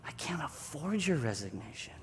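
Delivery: soft, near-whispered insistence; hushed refusal. Acted. Jesus refuses Peter's resignation, hushed. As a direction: say it intimately under the breath. Voicing Jesus, intimate reply.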